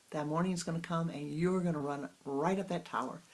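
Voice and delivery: normal talking voice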